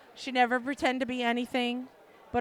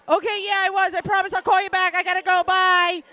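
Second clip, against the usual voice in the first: higher, louder, hurried brush-off; loud rushed excuse; 6 semitones higher, 8 dB louder than usual. Retold or imitated. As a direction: blurt and hang up fast. Rushing to hang up.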